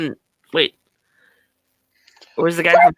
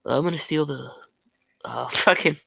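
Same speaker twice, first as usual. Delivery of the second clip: Nicolas Cage impression; 4 semitones lower, 8 dB quieter than usual. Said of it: "Nicolas Cage".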